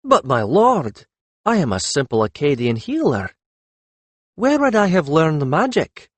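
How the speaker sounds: high, guileless protest; ingenuous, lifted questioning; innocent as a child. A narrator's reading. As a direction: plead ignorance with feigned innocence. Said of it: "looked as innocent as a child".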